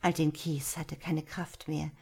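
plain reading voice

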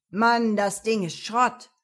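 loud, mocking goat-like man; sly goat man voice. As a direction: crow it out with scorn. Mechanic mocks wrecked car. (lauter, spöttischer Ziegenmann)

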